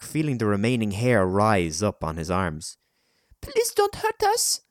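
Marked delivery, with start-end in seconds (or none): 3.4-4.6 put-on very high, pleading child; child's voice. A narrator's reading. Child in a bush pleads with a stranger, high voice. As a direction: plead in a small, foreign child's voice. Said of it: "the voice was definitely a child's".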